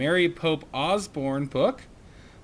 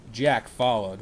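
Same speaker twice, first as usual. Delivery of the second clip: plain reading voice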